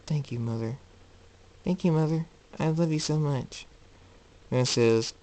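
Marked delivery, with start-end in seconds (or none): 1.6-3.7 put-on high, childlike grateful voice; little colt. Colt hugging and thanking his mother, childlike. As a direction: lift it into a small child's voice. Retake in the colt's voice.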